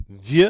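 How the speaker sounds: loud VIEUX with no X sound; silent final X. Shown as a demonstration. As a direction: end "vieux" on the vowel, no X. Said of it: "we don't pronounce that final X".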